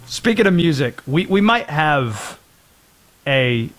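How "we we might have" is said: ordinary delivery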